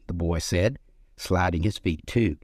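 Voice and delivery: ordinary delivery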